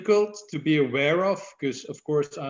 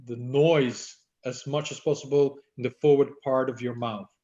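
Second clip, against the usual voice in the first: ordinary delivery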